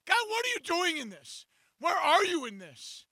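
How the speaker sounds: high, pleading cry; desperate plea, voice lifted; anguished cry to God. Retold or imitated. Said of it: "I'm like".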